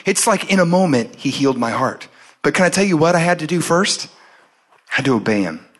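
usual speaking voice

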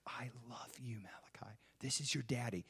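very soft, hushed soothing; gentle, barely voiced reassurance; tender whisper. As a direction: whisper lovingly to a crying newborn. Father soothing crying newborn, retold.

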